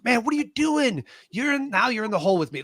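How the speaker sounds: high-pitched incredulous protest; acted exasperated disbelief. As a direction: question him in mock disbelief, rushing on. Stuck soldier reacts in disbelief.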